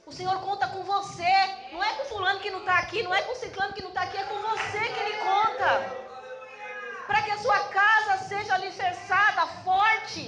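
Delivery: loud, high-pitched fervent exhortation; urgent, ringing pulpit proclamation; full-force preaching. Real, not acted. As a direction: preach it straight at each listener.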